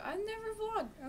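slow, very high little voice; high drawn-out mock voice. Starting a first vlog with an acted aside, high drawn-out mock voice. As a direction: stretch it out way up high. Acts out a squeaky quote.

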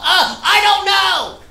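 high, slow flustered answer with a laugh; nervous stammer. Retold or imitated. Shy teen answering his crush, high and flustered. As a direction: choke it out like a panicked teen. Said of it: "I'm so nervous".

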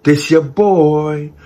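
slow, loud, stretched hype intro; drawn-out intro. Acted. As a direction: stretch every word out like a host.

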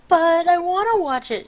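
put-on high, loud childlike protest; mock pleading. Acts own protest against the internet.

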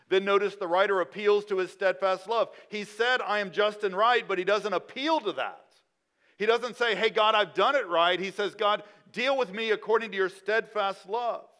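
loud, high preaching voice; preaching at full force. Acted. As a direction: drive it home from the pulpit.

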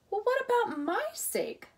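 high, indignant thought. A narrator's reading. Ralph offended that nobody cares for him, indignant. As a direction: huff it out, offended. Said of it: "thought Ralph indigently".

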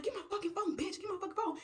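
high-pitched angry demand; furious demand. Acted. Friend about to fight for her phone, furious.

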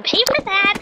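high, fast squeal of revenge; rising, worked-up threat; vengeful outburst. Acted. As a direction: vow payback in a high-pitched fury. Vows revenge, escalated take.

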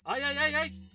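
high loud alarmed ay ay ay; panicked yelps. Real, not acted. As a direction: yelp as the enemy charges. Enemy rushing in.

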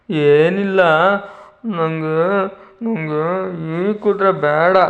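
put-on very slow, whimpering child voice; crying child. Tenali as a child refusing the toy horse to the king, slow whimpering. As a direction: whine and drag every word like a tearful kid. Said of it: "ಮತ್ತೆ ಅಳೋದಕ್ಕೆ ಪ್ರಾರಂಭ ಮಾಡ್ದ".

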